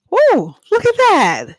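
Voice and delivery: high, loud woo of surprise; a bright whoop, like finding something good; pleasantly surprised exclamation; delighted surprise. Real, not acted. Opening the book at random.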